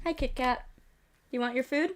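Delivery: soft, gentle pet talk. Real, not acted. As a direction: coax the cat softly. Talking softly to her cat.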